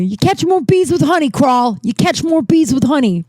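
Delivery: high-pitched imitation of a yelling boss; mock-yell it like a New York boss; Mikey yelling. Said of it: "he used to always yell".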